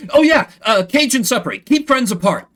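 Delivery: Larry reciting his training rule to the captain; eager recital. Acted. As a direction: blurt the rule proudly.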